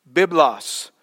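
deliberate Greek pronunciation of "Biblos"; slow Greek reading. Shown as a demonstration. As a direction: sound out "Biblos" for listeners. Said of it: "read it again slowly in Greek".

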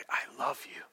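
soft, low whisper; intimate vow. Acted. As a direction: whisper it to a spouse at the altar. Vow to spouse, acted.

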